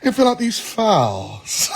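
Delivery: slow mock-British voice; British accent, elongated vowels. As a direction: put on a British accent and stretch the final vowel. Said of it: "end with an elongated vowel sound".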